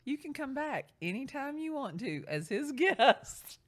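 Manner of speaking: high, loud, breaking into laughter; laughing tease. Retold or imitated. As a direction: tease her while giggling. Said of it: "my client goes".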